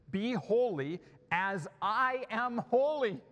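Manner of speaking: slow, loud, high proclamation; declaimed command. Acted. Preacher quotes God's command from Leviticus, declaiming.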